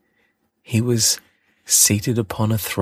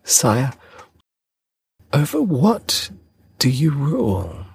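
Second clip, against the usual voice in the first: put-on curious boy; 8 semitones higher than usual. A narrator's reading. Little prince asks the king.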